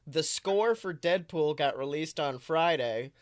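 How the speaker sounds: normal talking voice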